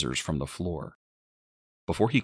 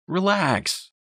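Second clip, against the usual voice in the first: high, raised-voice brush-off; irritated dismissal; 14 semitones higher, 4 dB louder than usual. A narrator's reading. Boyfriend brushes off upset mother, irritated raised voice. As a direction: brush them off, annoyed.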